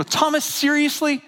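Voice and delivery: high, drawn-out incredulous scolding; imagined incredulous rebuke; mock chastising. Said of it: "doesn't chastise or condemn".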